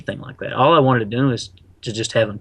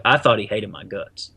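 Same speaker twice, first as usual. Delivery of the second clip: ordinary talk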